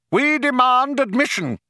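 high-pitched, strident demand; demanding shout. A narrator's reading. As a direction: call it out high, demanding entry. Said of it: "shouted the younger of the two men".

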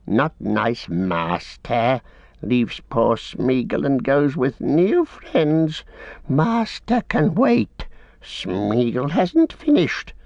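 sulky Gollum. A narrator's reading. Gollum refusing master, in a slow whining creature voice. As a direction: drag it out like a sulking creature.

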